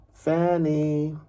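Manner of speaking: high, loud call into an empty shop; sing-song call of two names. A narrator's reading. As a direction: call the names out across the room. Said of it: "Mary called out in the empty shop".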